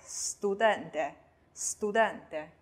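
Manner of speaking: studente with a hissed s before t; voiceless s. Shown as a demonstration. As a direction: model studente slowly, unvoiced s first. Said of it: "第三个词s位于句首啦".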